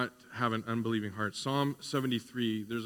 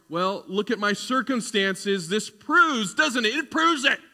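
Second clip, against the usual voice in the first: higher and louder, indignant complaining; voice of doubt; 9 semitones higher, 9 dB louder than usual. Acted. Preacher voices his own doubt protesting to God, indignant and high. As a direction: play the whining inner doubter, worked up. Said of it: "My doubt would say right now".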